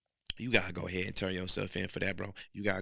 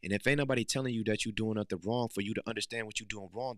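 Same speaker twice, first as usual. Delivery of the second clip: usual speaking voice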